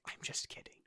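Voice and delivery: whispered take-back, very soft; cracked-lip smile. Acted. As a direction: whisper it through a thin smile. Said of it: "smiles a cracked lip smile".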